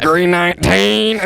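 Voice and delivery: slow, high play-by-play call; sportscaster voice. Pretend. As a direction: call it like a game clock. Joins the mock play-by-play.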